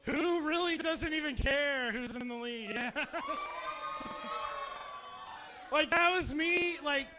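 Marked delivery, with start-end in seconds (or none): 3.0-5.7 a real high-pitched laugh; big laugh. Real, not acted. Big laugh at camp memory.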